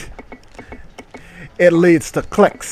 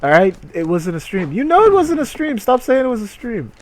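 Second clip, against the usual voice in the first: exasperated; 4 semitones higher, 6 dB louder than usual. Real, not acted. Scolding chat about stream.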